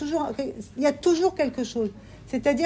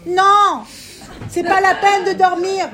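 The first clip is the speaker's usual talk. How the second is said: loud, high burst of refusal; emphatic no; 7 semitones higher, 8 dB louder, slower than usual. Real, not acted. Teacher rejecting the insomniac's worry, loud. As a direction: cut them off with a shouted no.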